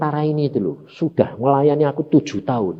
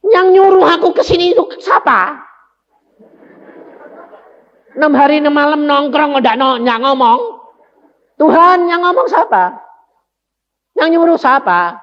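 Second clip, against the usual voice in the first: loud, high exasperated complaint to God; frustrated outburst; 11 semitones higher, 4 dB louder than usual. Retold or imitated. Preacher retelling his outburst at God, loud and exasperated. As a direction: throw the question up in irritation.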